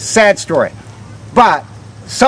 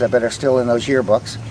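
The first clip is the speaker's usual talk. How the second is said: ordinary talk, nothing marked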